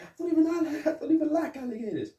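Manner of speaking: high, fast put-on squeak; squeaky mock voice. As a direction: rattle it off in a silly high voice. Mock-voicing a character.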